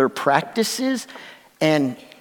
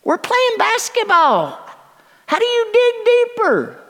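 high, very slow, drawn-out coach's call; coach pushing a player; 5 dB louder, much slower than usual. Retold or imitated. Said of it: "pick out an individual player and say".

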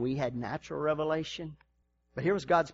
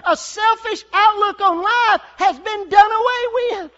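preaching shout; 11 dB louder than usual. Acted. Pastor declaring to the congregation, shouting. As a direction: preach it at full force.

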